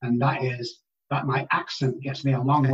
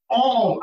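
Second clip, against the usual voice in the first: all punched high and louder; stress on all; 8 semitones higher, 3 dB louder than usual. Real, not acted. Guest stressing that all problems would vanish, punched stress. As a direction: hit the word all as if spelled out in capitals. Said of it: "I mean A L L capitalized".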